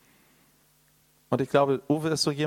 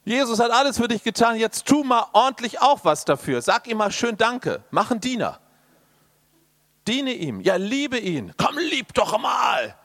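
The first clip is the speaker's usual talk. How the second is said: much higher and louder mock demand, ending in a shout; mock pushy preacher; 8 semitones higher, 3 dB louder than usual. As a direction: ham up a preacher pushing you to do more. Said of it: "Und dann höre ich sowas".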